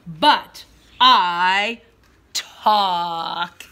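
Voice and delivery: loud, emphatic storybook line; loud proud declaration. Pretend. Teacher reading to pre-K class, loud emphatic line. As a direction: proclaim it to the class.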